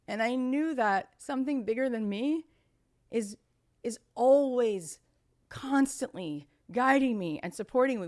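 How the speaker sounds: ordinary delivery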